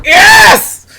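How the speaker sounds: loud, high burst of joy; excited yell. Retold or imitated. Recalling her thrill over the voice actor, excited shout. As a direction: shout it out like you just got great news. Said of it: "And he talked like this".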